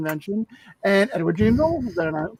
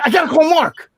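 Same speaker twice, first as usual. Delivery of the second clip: higher, louder mock urgency; urgent insistence; 8 semitones higher, 5 dB louder than usual. New mother insisting on calling her friend, mock urgent. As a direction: imitate a wife who simply has to make this call. Wife insisting after birth.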